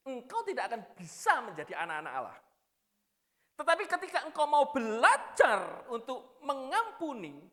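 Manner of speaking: loud, ringing sermon voice pressed out high; forceful preaching. Acted. Preacher urging the congregation to forgive, loud preaching. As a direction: preach it full force to the hall.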